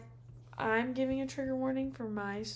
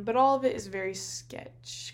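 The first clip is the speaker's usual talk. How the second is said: ordinary talk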